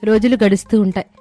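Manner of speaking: ordinary delivery, nothing marked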